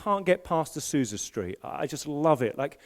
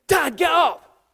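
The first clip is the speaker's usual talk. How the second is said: put-on kid yelling dad awake; 10 semitones higher, 6 dB louder, slower than usual. Son wakes dad in story.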